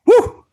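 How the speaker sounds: short high-pitched "woo"; excited whoop. Real, not acted. Host cheering the start of reviews, excited whoop. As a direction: let out a quick high whoop.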